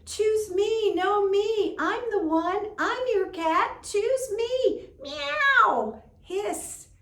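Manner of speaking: high, loud clamouring cat chorus; a crowd of cats all yelling at once, ending in a meow and hiss; squabbling cats. Acted. Cats all speak together.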